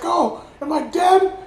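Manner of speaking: high, stretched alarmed question; mock shocked, pitch shooting up; imitating a startled hero. Acts out Peter's startled question.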